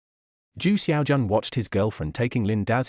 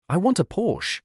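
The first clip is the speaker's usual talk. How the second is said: higher, pushy demand; aggressive demand; 8 semitones higher than usual. A narrator's reading. Girlfriend demanding a Porsche, aggressively. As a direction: push it at him like a demand. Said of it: "said aggressively".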